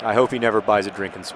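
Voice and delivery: ordinary delivery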